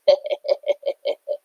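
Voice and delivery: high breathy jeering laugh; mocking cackle. A narrator's reading. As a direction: cackle at them. Rivals laugh.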